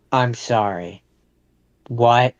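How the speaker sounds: ordinary delivery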